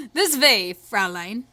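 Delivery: mock German with V for W; lightly German-accented gallant invitation; makeshift German accent. Gentleman leading a young lady in, rough German accent. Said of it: "switch my W's with V's".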